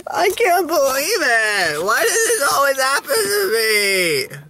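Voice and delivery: fake-sob like the world has ended; mock crying wail. To the camera over the missed race, hammed-up crying wail. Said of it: "now I just wanna cry".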